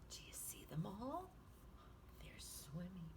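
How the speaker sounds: put-on hushed whisper to the kids. Teacher pointing out the jellyfish to children, hushed whisper. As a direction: whisper it like sharing a secret.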